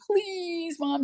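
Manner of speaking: drawn-out, high mock-tearful pleading; crying plea. Crying children begging their mother, imitated high plea. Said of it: "they're crying".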